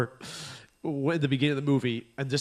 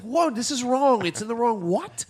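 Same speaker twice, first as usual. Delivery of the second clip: shocked date; 7 semitones higher than usual. Retold or imitated. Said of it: "It's like".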